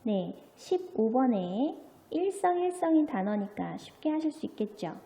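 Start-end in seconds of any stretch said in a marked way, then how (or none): none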